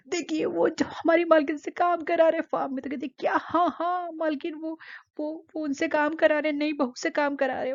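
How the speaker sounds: high, excited tattling maid's voice; gossiping maid. Retold or imitated. Maid runs to the aunt to tell tales, high and excited. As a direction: rush to tell on them in a high voice.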